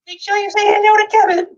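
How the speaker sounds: high, sweet put-on granny voice; little old nan. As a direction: squeak it out like a tiny old lady. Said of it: "and she said".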